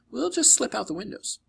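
put-on a clever girl thinking fast; girl's voice. Annabeth voiced, higher.

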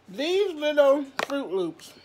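high, slow, drawn out exasperation; exasperated. Real, not acted. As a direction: drag it out in mock disbelief.

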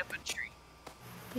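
whispering. Real, not acted. Game chatter, whispered.

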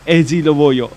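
loud brash gangster imitation. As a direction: play it like a street thug. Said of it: "faccio tipo".

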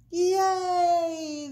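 high, loud, long-held yay; crowd cheer. A narrator's reading. Crowd celebrates Big Bark's capture, cheering. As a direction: let out a drawn-out hooray. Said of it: "the crowd cheered".

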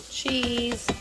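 ordinary talk

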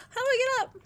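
high, loud flustered cry; flustered shout. Real, not acted. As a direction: call out in a high-pitched fluster. Fallen, struggling in game.

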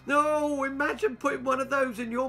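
put-on high, disgusted squeal of a little girl. A narrator's reading. Girl squealing at her friend, high and disgusted. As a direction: squeal it out, grossed out. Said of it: "squealed Tanya".

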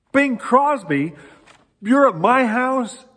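high, loud, drawn-out amazement; astonished. Retold or imitated. Lady finding [name] at her door at night, astonished. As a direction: gape in disbelief, stretching every word.

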